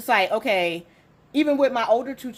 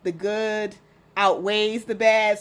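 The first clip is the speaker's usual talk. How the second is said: normal talking voice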